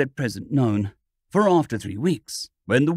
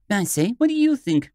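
eager cry; 7 semitones higher than usual. A narrator's reading. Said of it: "cried a girl's voice".

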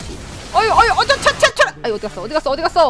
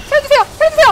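mock plea; 10 semitones higher, 5 dB louder, much faster than usual. Fleeing in game, mock plea.

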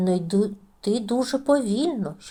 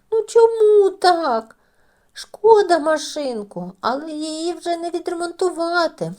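tearful whine; 8 semitones higher, 5 dB louder than usual. A narrator's reading. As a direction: wail over the broken toy. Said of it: "Тім плаче". (плаксиво й високо, протяжно)

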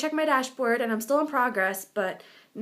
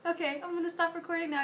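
ordinary talk

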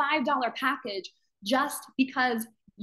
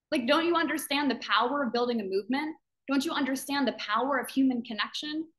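ordinary talk

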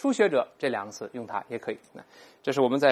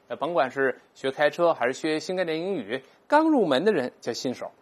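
ordinary talk, nothing marked

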